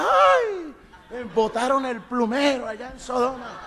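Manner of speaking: high squealing mock cry of delight, laughing; mocking effeminate voice. Preacher mocking Sodom's men, high laughing camp squeal.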